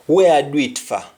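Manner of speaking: "where I do it for" in a Jamaican Patois lilt, "for" as "fa"; a puzzled Patois question. Shown as a demonstration. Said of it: "somebody would say".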